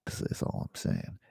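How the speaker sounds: breathy whisper, just under the voice; whispered aside. Real, not acted. Whispering a sheepish aside about deadly farts. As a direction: let it slip out as a secret.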